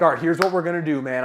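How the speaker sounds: loud, mock-urgent take-charge announcement; battle mode. Coach rescuing a negative guy's attitude, battle mode. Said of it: "I'm, like, in battle mode".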